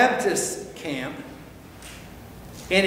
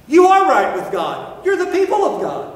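proud, louder then much higher and faster; smug orthodoxy; 8 semitones higher, 6 dB louder than usual. Retold or imitated. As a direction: crow it like a self-assured priest. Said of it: "religious orthodoxy taught".

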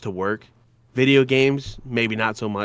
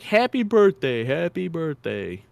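lightly mocking singsong greeting; high, teasing birthday call; retelling the coworker's needling greeting; 7 semitones higher than usual. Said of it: "coworker that was like".

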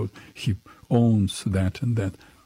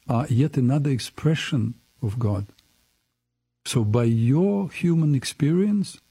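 ordinary talk, nothing marked

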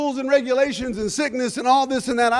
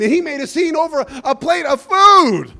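higher, louder preaching outburst; loud incredulity; 5 semitones higher, 7 dB louder than usual. Real, not acted. Preacher decries a man's scene over food, loudly incredulous. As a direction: hammer it home with a raised voice.

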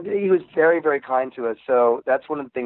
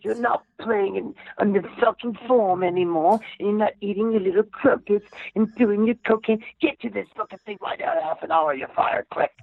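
raised, harsh Australian boss voice barking orders; mock Australian accent; 8 semitones higher than usual. Dispatcher yelling at couriers over the radio, mock Australian accent. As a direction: ham up a brutal Australian dispatcher. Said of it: "a really strong, Australian accent".

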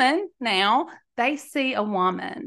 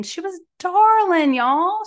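gushing; 8 semitones higher, 5 dB louder than usual. Real, not acted. Gushing over the girl.

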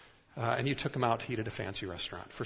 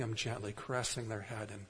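tender hush; 6 dB quieter than usual. Real, not acted. Describing sleeping child tenderly.

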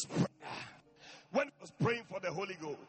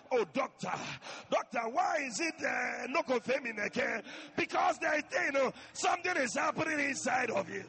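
louder pleading cry to the doctor; woman in labor; 5 semitones higher, 7 dB louder than usual. Retold or imitated. Woman in labor calling the doctor, mock distress. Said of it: "when the women are in labor".

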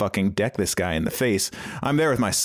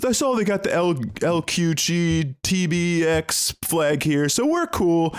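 high, strained loudmouth voice; mock the egged-on heckler; imitated belligerent fan; 7 semitones higher, slower than usual. Imitating a fan his friends egg on, belligerent. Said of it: "egging him on almost".

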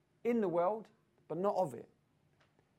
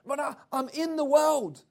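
higher, louder imitation of a worldly believer; 6 semitones higher, 8 dB louder than usual. Mocking Christians who only stress being in the world. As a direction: parody their self-assured claim. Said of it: "this is what they emphasize".

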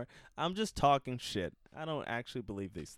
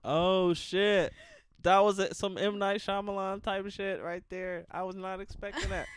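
shocked; 8 semitones higher, 7 dB louder than usual. Real, not acted. Listener shocked by twist.

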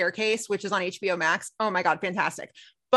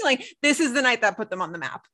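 usual speaking voice

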